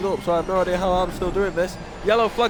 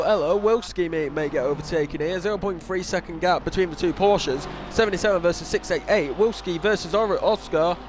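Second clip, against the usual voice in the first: ordinary talk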